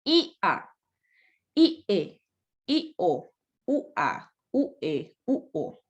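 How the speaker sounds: "I A" as two beats, first vowel stressed; hiatus. Shown as a demonstration. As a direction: separate each pair like "U O", leaning on the first. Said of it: "No digo ia".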